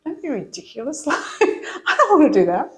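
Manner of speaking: a touch of mock protest, breaking into laughter; laughing refusal. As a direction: laugh it off as she says no. Refusing God's call, laughing.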